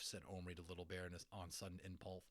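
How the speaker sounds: ordinary delivery, nothing marked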